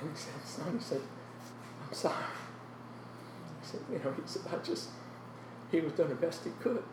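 real tears choking a soft voice; hushed, voice giving way with emotion; close to crying. Real, not acted. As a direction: fight back tears while telling it. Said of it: "I'm gonna cry".

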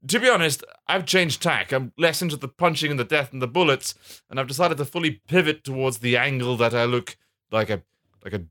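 villain chattily confessing; lightly voiced comic baddie; bad man. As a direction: start high and tail off sheepishly. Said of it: "the bad man".

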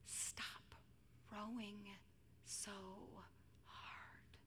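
slow near-whisper of comfort; hushed, halting divine reassurance; tender beckoning. Acted. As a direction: beckon a tired child gently, pausing between words. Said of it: "Jesus beckons".

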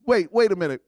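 normal talking voice

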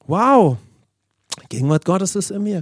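awed exclamation. Shown as a demonstration. Preacher showing believers what to say at home, awed exclamation. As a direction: marvel aloud with a high swooping wow.

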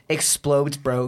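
ordinary talk, nothing marked

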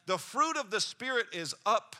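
the loud take of the fruit line; loud preached line. Acted. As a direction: call it out over the room.

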